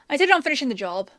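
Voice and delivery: normal talking voice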